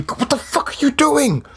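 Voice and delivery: Jay squeaking in disbelief at the flailing barbarian; shocked high outburst. Acted. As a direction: squeak out the disbelief at the spear-thrower. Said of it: "Jay just turns to you".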